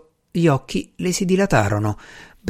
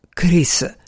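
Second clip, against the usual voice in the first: whispery, higher murmur of his name; faint stammer; 4 semitones higher than usual. A narrator's reading. Woman with face in his shoulder, faint stammer. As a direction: breathe the name out weakly. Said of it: "balbettò affondandomi il viso nella spalla".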